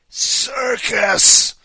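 high, loud strained croak; desperate croak. Acted. Gagged contestant answering the man with the bow tie, croaking. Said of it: "he croaked".

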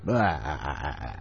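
a soft, low vampire blah; low mock vampire blah. Shown as a demonstration. A man showing how to greet people in public, in a low vampire blah. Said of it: "start with".

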